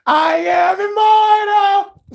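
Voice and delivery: high, slow, loud hammed-up declaration; over-the-top epic movie line; mock immortal proclamation. Quoting Highlander dramatically.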